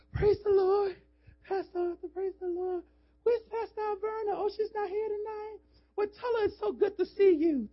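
high sugary church-lady voice, well above his own; mock church lady. Preacher mimicking a flirting church sister, high and sweet.

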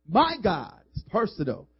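slow, loud, drawn-out preaching of scripture; preaching the verse. Real, not acted. Pastor thundering scripture to the congregation, slow and loud. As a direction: proclaim each word.

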